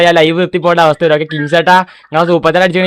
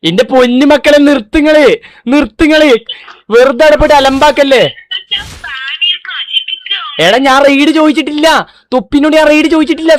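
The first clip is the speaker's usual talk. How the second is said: exasperated shouting; 9 semitones higher than usual. Real, not acted. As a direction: yell at the spammers to knock it off. Streamer begging chat to stop spam. (ചാറ്റിനോട് ഉച്ചത്തിൽ കെഞ്ചുന്നു)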